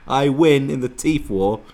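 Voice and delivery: loud, high, slow gloat; mock triumph. Host claiming he wins the teeth war, loud gloating. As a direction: proclaim victory, drawn out and high.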